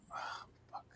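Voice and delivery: breathy mock whisper of defeat; whispered curse. A boy told no by his dad, muttering under breath. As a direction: whisper it under your breath like a let-down kid. Kid retelling dad's refusal.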